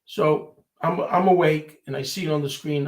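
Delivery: ordinary talk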